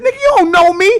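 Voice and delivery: high, loud retort from a retold quarrel; acted angry yell. Confronting the passenger, acted angry yell. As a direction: fire it back at full volume, play-acting.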